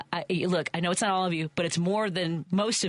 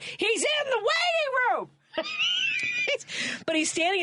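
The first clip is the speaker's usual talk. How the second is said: much higher, slow, laughing disbelief; shocked laughing shout. Real, not acted. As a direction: cry it out as if you can't believe it. The rude man turns up again.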